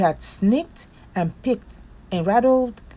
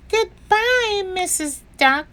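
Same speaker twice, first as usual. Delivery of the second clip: put-on much higher, tiny child's voice; small voice; 14 semitones higher than usual. A narrator's reading. Bear under the covers, saying a small goodbye. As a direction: say it small and hidden. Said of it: "His voice sounded very small".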